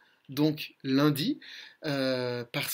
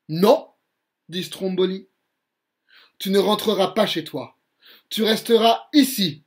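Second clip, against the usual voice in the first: put-on lively read-aloud for children; a bedtime-story voice that acts out every character; storybook narration; 3 semitones higher, 8 dB louder than usual. A narrator's reading. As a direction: tell the tale with colour and energy.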